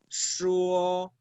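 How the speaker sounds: "shuo" with the tongue pulled back; Mandarin sh sound. Shown as a demonstration. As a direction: draw out "shuo" on a level note. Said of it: "la lengua va como más hacia atrás".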